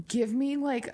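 usual speaking voice

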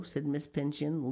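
plain reading voice

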